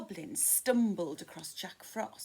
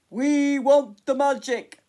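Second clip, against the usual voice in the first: a much louder rough roar; roaring goblins; 6 semitones higher, 15 dB louder than usual. Acted. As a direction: bellow it as a mob of goblins. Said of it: "roared the goblins".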